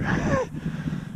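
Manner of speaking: quiet unvoiced chuckle; a short airy laugh; soft breathy laugh. Real, not acted. Laugh after quiet remark.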